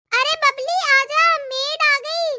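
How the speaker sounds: high, slow call from another room. Acted. Mother calling daughter from afar, loud and high. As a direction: call her over loudly and slowly.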